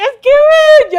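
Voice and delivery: high, loud wail as if sobbing; imitated crying. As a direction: cry out the words like a tearful friend. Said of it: "yo llorando en el restaurante". (llanto imitado, agudo y fuerte)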